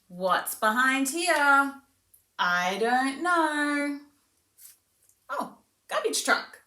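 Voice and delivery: high, slow, sing-song wonder; slow teasing sing-song for a toddler; playful demo to child. Shown as a demonstration. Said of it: "We might say something like".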